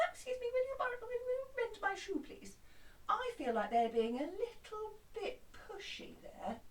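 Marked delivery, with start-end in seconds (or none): 0.1-2.6 a lightly voiced polite customer, pitched up. Customer politely asking the cobbler for help, mildly voiced. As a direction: ask nicely in a high, light voice. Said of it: "the customer coming in".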